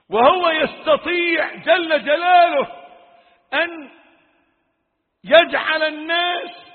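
high, loud warning that builds; fiery preaching. Real, not acted. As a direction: hammer each threat home at full force. Full-force warning. (وعظ ناري بصوت عالٍ)

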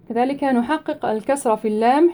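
ordinary talk

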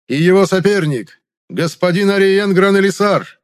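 high, loud herald proclamation; town crier. A narrator's reading. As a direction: proclaim the king's will to the square. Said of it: "докрикивая своим мощным голосом".

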